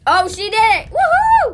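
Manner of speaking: high, loud woohoo; excited cheering. Real, not acted. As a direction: cheer wildly. Friend swallows.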